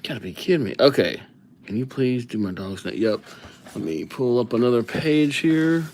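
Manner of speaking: ordinary delivery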